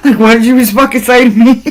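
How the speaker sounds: high, fast, laughing fake fury; mock outrage laughing. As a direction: pretend to be insulted while cracking up. Acted offended reaction, laughing.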